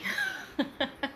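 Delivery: light, high chuckle; soft laugh at a pet's mischief; amused laugh. Real, not acted. Owner caught the dog eyeing her coffee, amused chuckle.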